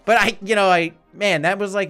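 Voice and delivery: ordinary delivery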